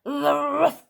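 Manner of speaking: loud mock dog yelp; playful dog bark. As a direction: bark happily with tongue out. Viking as dog.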